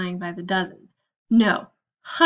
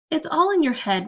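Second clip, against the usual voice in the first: ordinary delivery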